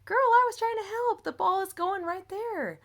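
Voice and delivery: high-pitched, pleading self-defense; exasperated protest. Retold or imitated. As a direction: re-enact an exasperated "I was only helping". Retells own protest in quarrel.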